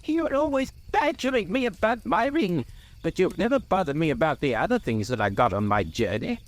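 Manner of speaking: high, irritated hobbit, easing as it runs on; sharp suspicion and annoyance. A narrator's reading. Bilbo accuses Gandalf of badgering him, sharply annoyed. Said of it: "sharp with suspicion and annoyance".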